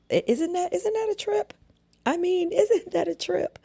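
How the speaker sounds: very high-pitched, laughing incredulity; amused disbelief. Real, not acted. Host marvels at boss's double standard, high amused disbelief. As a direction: squeal it with a laugh, voice way up.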